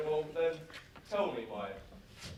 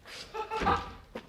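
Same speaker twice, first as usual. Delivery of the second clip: laughing; 14 semitones higher, 4 dB quieter than usual. Acted. Heracles bursts out laughing.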